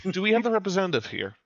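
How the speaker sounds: ordinary talk, nothing marked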